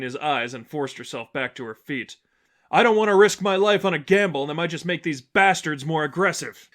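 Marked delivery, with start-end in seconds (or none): 2.7-6.7 a loud, higher, insistent refusal; raised objection. Acted. Huntress objecting while back on her feet, loud and insistent. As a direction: push back hard over the fight.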